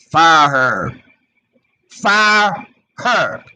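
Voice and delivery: loud, drawn-out order; emphatic public demand; forceful demand. Real, not acted. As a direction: demand it loudly, rising on the repeat. Demanding the cop be fired.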